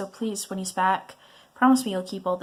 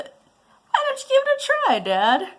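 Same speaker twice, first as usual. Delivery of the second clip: much higher mischievous offer; sly tease; 5 dB louder than usual. Acted. Son goading dad to taste the sauce, high and sly. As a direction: lure him in with a high sly voice.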